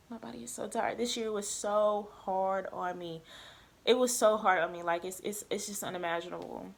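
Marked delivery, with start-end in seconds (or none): 0.1-1.0 weary near-whisper, drained; soft tired murmur. Real, not acted. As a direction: breathe it out, exhausted. Exhausted, repeating herself softly.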